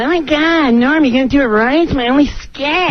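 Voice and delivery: hammed-up needling co-star; whiny Katan. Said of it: "He's going".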